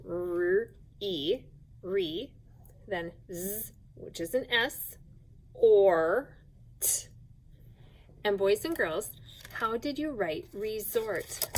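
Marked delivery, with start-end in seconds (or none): 0.1-7.1 slow spelling aloud. Shown as a demonstration. Teacher spelling out resort slowly for second graders. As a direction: spell out each sound slowly, one at a time. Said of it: "slow spelling out sounds".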